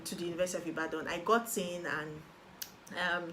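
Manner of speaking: usual speaking voice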